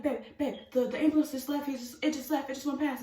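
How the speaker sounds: mildly frantic, repeating in disbelief; freaking out. Retold or imitated. Telling boyfriend the ambulance just left, freaking out. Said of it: "like, freaking out".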